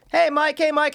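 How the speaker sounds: put-on high, bright kid calling out. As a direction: call like an excited little boy. Neighbour kids greeting him.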